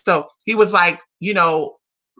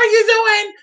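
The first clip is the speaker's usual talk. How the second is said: flirty hostess call; 12 semitones higher than usual. Pretend. Joking to viewers, summoning Arabic men in a high sing-song. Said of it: "All the Arabic men, please come".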